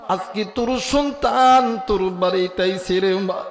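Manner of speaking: usual speaking voice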